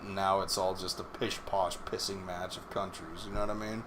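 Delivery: normal talking voice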